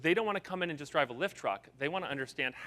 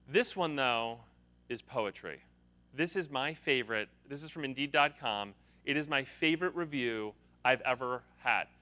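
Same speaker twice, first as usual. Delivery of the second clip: usual speaking voice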